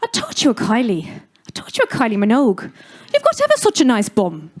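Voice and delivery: loud, cheeky Irish aunt in a self-confessed bad Irish accent. Irish aunt teasing me after the funeral, bad Irish accent. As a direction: do the Irish aunt badly, with a laugh at the end. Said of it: "excuse my bad accent".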